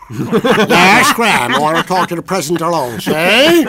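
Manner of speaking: high, loud gangster snarl with a cigar; Edward G. Robinson impression. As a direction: talk tough like Little Caesar. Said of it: "I did an imitation of Edward G. Robinson".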